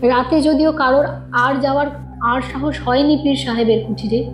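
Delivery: ordinary delivery, nothing marked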